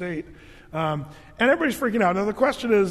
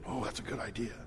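much softer aside; 9 semitones lower, 13 dB quieter, faster than usual. Acted. Daughter replies in joke.

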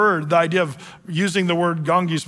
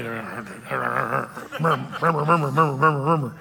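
soft, lower mock murmur; murmuring sound; 5 semitones lower, 3 dB quieter, slower than usual. Shown as a demonstration. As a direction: make the murmur sound quietly under your breath. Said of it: "it's automatopoeia".

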